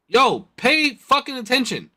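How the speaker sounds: high, slow, annoyed yell; annoyed shout. Retold or imitated. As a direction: snap at the driver. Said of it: "and then I was like".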